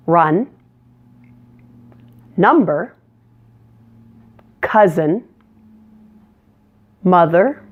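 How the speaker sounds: each word with a clear short uh vowel. Shown as a demonstration. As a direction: model fun, cut, run with a relaxed uh. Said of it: "Let's practice it in some words".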